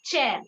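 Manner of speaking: Chair with a hard "tch" start; English "ch" sound. Shown as a demonstration. Teacher giving an English example, Chair with "tch". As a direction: begin Chair with a crisp English ch. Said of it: "We pronounce either a cha sound".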